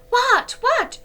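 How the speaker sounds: high, loud startled cry; frightened girls crying out; alarmed question. A narrator's reading. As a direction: gasp it out in alarm.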